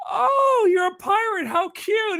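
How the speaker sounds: put-on very high, cooing old lady voice; delighted old lady. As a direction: gush over the kid's costume. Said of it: "She goes".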